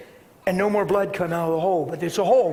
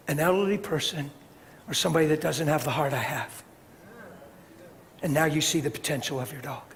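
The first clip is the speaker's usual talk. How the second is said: softer, serious and low; quiet earnest talk; 5 semitones lower, 7 dB quieter than usual. Retold or imitated. To the dog owners about mercy, quiet and earnest. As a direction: lean in and speak gravely.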